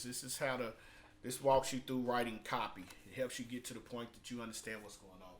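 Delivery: ordinary talk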